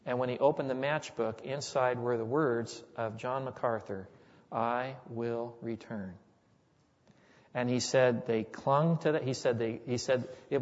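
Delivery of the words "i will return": solemn promise. Retold or imitated. General's promise read out solemnly.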